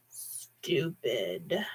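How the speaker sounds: low soft contemptuous rasp; raspy sneer. A narrator's reading. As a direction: hiss the word low and cold. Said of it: "the voice said again".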